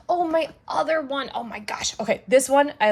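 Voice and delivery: gush, voice jumping up in pitch and volume; really excited. Real, not acted. Reviewer spotting a favorite wax melt, really excited. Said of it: "sorry, I got really excited".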